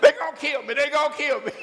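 high, loud hammed-up panic, half laughing; a comic terrified cry; mock panic. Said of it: "He was scared to death".

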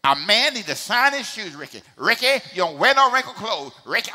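imitated mama ordering her son about; scolding mama. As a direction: play a mama nagging her boy. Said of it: "That's what my mama said".

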